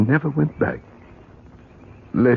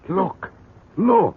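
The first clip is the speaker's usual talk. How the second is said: excited; 12 semitones higher, 4 dB louder than usual. Acted. Showing neighbour the stone find.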